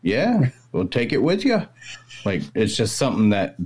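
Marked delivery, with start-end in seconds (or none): 0.0-1.7 put-on Maine accent pitched much higher; cheery Maine old-timer pushing the gift on Bob; a hammed-up Maine accent, high and friendly; old-timey Mainer. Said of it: "old-timey Mainer's house".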